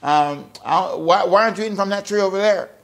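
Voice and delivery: lightly voiced, coaxing serpent; a touch of a wheedling tempter, quick; sly serpent. Serpent tempting the woman, lightly coaxing. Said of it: "The serpent says basically".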